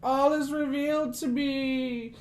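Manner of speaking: high, loud, long-drawn rapturous cries; ecstatic revelation. Acted. Cultist writhing on the ground as all is revealed, ecstatic. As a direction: cry out slowly, overcome by a vision.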